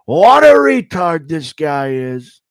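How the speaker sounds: hammed-up Trump voice; Trump impression. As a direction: draw it out loud and high. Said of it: "Mason Trump. Describing Brendan Schaub impression".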